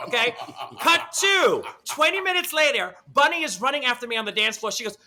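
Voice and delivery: usual speaking voice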